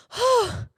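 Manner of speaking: imitated high-pitched gasping cry; high relieved gasp. Mother hearing her newborn's first cry, imitated gasp. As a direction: let out a short high gasp, falling. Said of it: "it wasn't a scream. It was like a".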